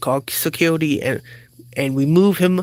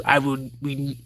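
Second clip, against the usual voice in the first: ordinary talk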